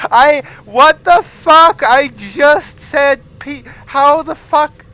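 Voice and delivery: a stunned cry of disbelief; shocked outburst. Real, not acted. Hearing that screaming pizza question, shocked yelling.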